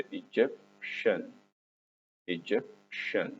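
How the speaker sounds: "Egyptian" said slowly, with the ending as "shun". Shown as a demonstration. Teacher showing pupils how the word ends, "Egyptian" with a slow "shun" ending. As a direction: split "Egyptian" before a clear "shun". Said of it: "والآي إي إن مع التي تنطق شن".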